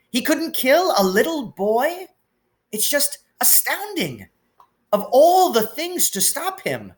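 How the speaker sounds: put-on high, anxious older woman, voice on the edge of breaking; worried Scottish professor pouring out bad news; trembling McGonagall. A narrator's reading. McGonagall figure held.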